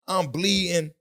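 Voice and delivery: ordinary talk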